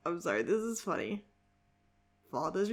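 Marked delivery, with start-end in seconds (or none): none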